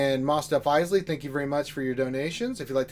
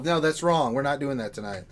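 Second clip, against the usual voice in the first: ordinary talk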